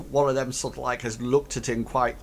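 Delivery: usual speaking voice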